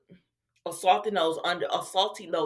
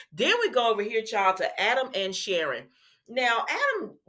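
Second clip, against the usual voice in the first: ordinary talk, nothing marked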